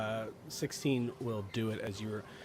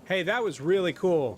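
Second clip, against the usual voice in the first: put-on high, loud, impressed teen voice; teen halfling boy; 9 semitones higher, 12 dB louder than usual. Halfling boy praises the girl's light, high and loud. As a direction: shout your admiration like an excited teenager. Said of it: "a halfling boy".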